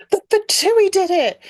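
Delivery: high, loud gasping accusation; excited exclaim. Retold or imitated. Paimon blurting her theory to Mona, high excited exclaim. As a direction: blurt it out like a sudden discovery. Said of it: "exclaims it".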